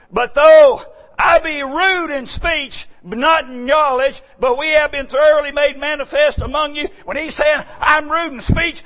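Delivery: high-pitched, forceful scripture preaching; emphatic preaching. Real, not acted. Preacher explaining Paul's rude speech to the congregation, emphatic preaching.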